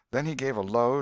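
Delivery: ordinary delivery, nothing marked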